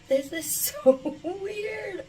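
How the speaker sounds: high, slow laughing amazement. Real, not acted. Laughing at how young she looks, high. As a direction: laugh through your disbelief.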